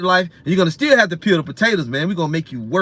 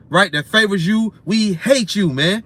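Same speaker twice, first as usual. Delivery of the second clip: normal talking voice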